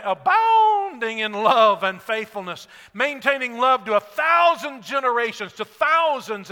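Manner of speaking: loud, slow, rising proclamation; fervent scripture reading. Acted. Preacher proclaims God's mercy to the church, loud and swelling. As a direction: let the praise swell out loud.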